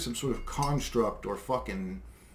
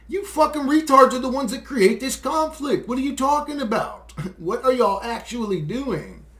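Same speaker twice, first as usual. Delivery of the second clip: higher, louder scornful yell; angry shout; 9 semitones higher, 6 dB louder than usual. Real, not acted. Cursing at leaders who start wars, angrily shouting. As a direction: bawl them out in open contempt.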